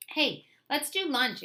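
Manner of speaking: high, loud, slow exclamation; exclaiming. A narrator's reading. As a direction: call it out eagerly. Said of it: "exclaimed Carla".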